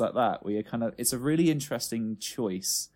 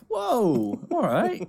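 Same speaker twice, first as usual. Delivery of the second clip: taken aback; 12 semitones higher, 4 dB louder, much slower than usual. Retold or imitated. Listener's taken-aback reaction.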